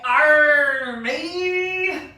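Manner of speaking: loud drawn-out pirate roar; pirate cry. Acted. Teacher roars as a pirate for the kids, loud. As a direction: bellow like a pirate charging the boat. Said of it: "and I say".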